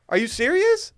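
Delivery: high, loud, hammed-up amazement; mock disbelief. Host wowed by the sponsor's sugar count, hammed-up disbelief. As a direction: exclaim it way up high, overdoing the shock.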